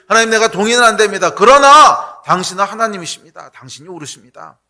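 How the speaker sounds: 높고 크게 외치는 고백; 힘차게 외치듯 고백하기; fervent declaration. Acted. Surrendered believer's prayer voiced. (자아가 부인된 사람이 하나님께 외치는 고백)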